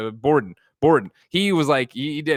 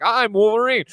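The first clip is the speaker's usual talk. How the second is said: higher, hammed-up hero announcement; mock Wolverine; 10 semitones higher, 4 dB louder than usual. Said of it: "He's like".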